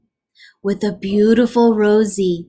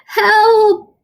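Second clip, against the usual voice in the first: high, loud yell; cry for help; 12 semitones higher, 7 dB louder than usual. Acted. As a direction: call out in alarm. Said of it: "cried the engineer".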